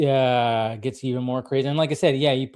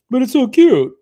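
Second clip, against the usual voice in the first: much higher, louder gushing voice; mock cooing; 11 semitones higher, 10 dB louder than usual. Carl gushing over the baby he was about to toss, high cooing. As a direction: gush over the baby like a big softie. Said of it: "but then he's like".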